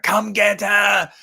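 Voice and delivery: high, slow yelled taunt; mock Loomis shout. Mock Loomis yelling at Michael, shouted high. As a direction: bellow it out to lure the killer.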